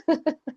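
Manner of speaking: real amused little laugh; light laugh. Real, not acted. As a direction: let out a brief chuckle. Amused at students smiling.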